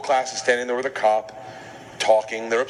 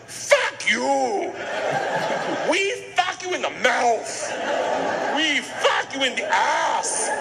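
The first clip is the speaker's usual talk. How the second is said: high, slow mock Russian gangster yell; Igor yelling; 10 semitones higher, much slower than usual. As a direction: bellow it at the cop like a drunk gangster. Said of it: "grabs him by the arm, spins him around, and goes".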